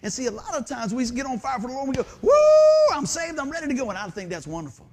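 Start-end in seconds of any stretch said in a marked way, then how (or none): 2.2-3.9 high, loud gleeful whoop; excited whoop. Retold or imitated. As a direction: whoop like a new convert. Mock on fire for Lord.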